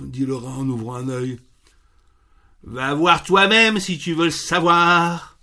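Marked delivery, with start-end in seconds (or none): 2.6-5.4 louder, grumbling rooster snapping back; surly rooster barking at the rat; grumpy snarl. Acted. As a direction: growl it out like a grouchy rooster. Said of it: "d'un ton hargneux".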